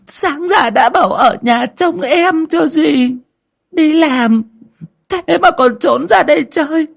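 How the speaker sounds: high, loud show of grief; choked crocodile-tear wailing. Acted. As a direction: wail theatrically for onlookers, stretching the last cry. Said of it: "ngẩng mặt lên mà nghẹn ngào". (giả vờ nghẹn ngào, gào khóc thảm thiết)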